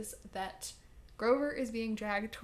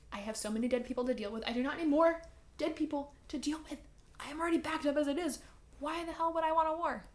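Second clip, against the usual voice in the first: higher, fast, fed-up god complaining; exasperated Hades; 5 semitones higher, faster than usual. Retold or imitated. Hades griping to Percy about his backlog of dead, high and rushed. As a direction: rattle it off like an overworked god. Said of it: "And Hades is like".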